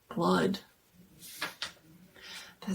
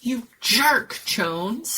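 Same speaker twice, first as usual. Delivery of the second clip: loud, higher angry yell; angry shout; 3 semitones higher, 4 dB louder, much slower than usual. Acted. Narrator shouting at Jones after the prank, angry. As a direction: snap at him. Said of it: "I shouted".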